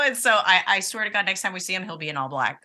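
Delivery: usual speaking voice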